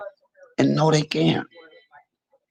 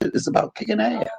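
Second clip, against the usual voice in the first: amused emphasis. Real, not acted. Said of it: "y'all think it's funny when I say it".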